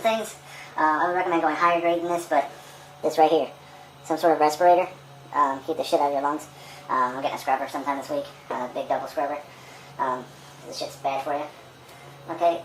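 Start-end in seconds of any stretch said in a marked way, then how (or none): none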